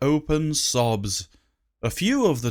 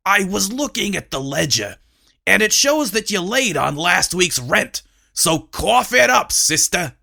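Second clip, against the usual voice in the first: pushy landlord; 5 semitones higher than usual. Acted. Tricky demands rent.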